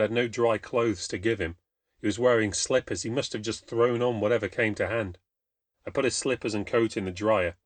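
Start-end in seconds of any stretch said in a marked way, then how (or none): none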